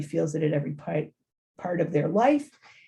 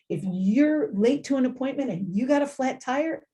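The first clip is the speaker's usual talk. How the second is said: ordinary delivery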